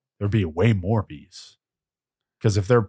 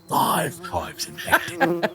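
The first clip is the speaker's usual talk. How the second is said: movie hero voice; 9 semitones higher, 4 dB quieter, much slower than usual. Retold or imitated.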